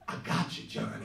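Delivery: soft, breathy reveal; sly whisper. Retold or imitated. Pacino revealing the prank to the waiter, in a sly whisper. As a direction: whisper it like a gotcha. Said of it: "he turns around and he goes".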